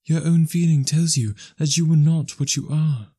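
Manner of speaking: put-on a fair young girl letting her lover go; young woman's voice. A narrator's reading. Belle, young woman voiced high.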